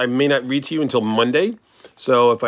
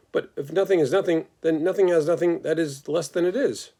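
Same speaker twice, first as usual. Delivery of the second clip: higher, earnest little pig reasoning it out; arguing pig voice; 5 semitones higher than usual. Acted. The pig argues with the lamb about nothing, high and earnest. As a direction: puzzle it through, eager and quick.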